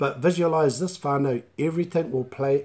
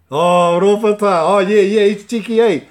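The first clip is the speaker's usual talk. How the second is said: loud amused exclamation; 7 semitones higher, 12 dB louder than usual. Real, not acted. Host reacting to a cheeky comment.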